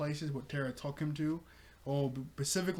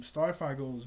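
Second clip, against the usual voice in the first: ordinary delivery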